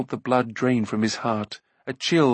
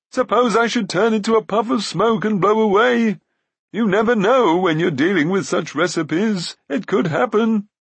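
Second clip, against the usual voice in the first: high, loud, babbling plea; agitated high pleading; pleading Glue; 9 semitones higher, 5 dB louder than usual. A narrator's reading. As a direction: wheedle anxiously in a whiny voice. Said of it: "pleaded Glue".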